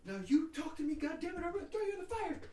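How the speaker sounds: mom threatening Chucky. Retold or imitated. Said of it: "and she's like".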